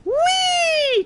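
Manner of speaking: high squealing whee; gleeful whee. Real, not acted. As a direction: squeal with joy. Jumping off in game.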